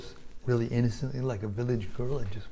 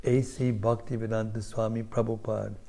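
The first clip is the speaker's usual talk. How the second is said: scripture reading; 6 dB louder than usual. Acted. Preacher reading the verse aloud to the congregation, solemn. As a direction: proclaim the holy text to the whole hall.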